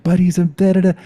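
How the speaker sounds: high, loud babbled objection; mocking protest. Storyteller mimicking brothers arguing back, mocking high protest. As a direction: mimic a whiny argument trailing into nonsense syllables.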